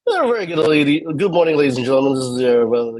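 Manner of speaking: quick, high American captain announcement; imitating an American pilot on the PA. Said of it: "it's more funny if you do the American".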